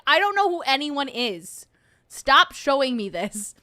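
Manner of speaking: acted exasperation. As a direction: tell them off in mock frustration. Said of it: "And I'm like".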